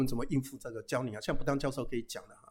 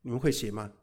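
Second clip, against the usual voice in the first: normal talking voice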